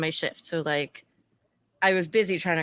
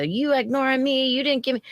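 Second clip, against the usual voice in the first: higher whiny voice imitating a drunk patron; mock drunk customer; 6 semitones higher than usual. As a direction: whine it like a drunk regular. Drunk customer mocked by bartender.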